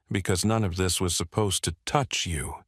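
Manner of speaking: usual speaking voice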